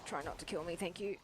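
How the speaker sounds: muttered aside. Real, not acted. Muttered aside during game.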